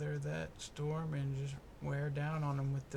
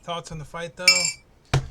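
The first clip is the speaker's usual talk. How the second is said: shout; 3 semitones higher, 16 dB louder, slower than usual. Real, not acted. Shouted question.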